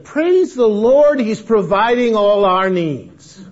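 high, loud and slow, a laughing hammed-up hallelujah; mock jubilant praise. Letter quoted in mock joy.

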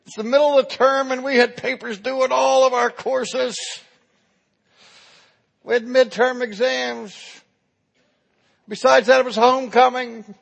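high, loud students' excuses and begging; pleading. Acted. Students begging the professor for mercy, pleading.

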